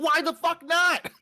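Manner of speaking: loud, high exasperated yell; mock outraged retort; indignant outburst. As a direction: fling the question back in disbelief. Retold outrage at subway lady.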